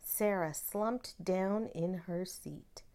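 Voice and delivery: usual speaking voice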